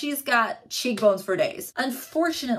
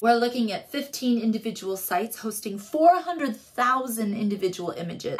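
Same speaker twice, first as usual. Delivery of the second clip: normal talking voice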